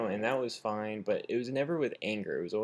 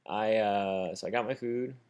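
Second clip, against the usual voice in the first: ordinary delivery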